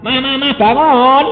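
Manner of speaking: calling mother awake. Retold or imitated. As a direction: call her out of the coma. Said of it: "Saya keluar".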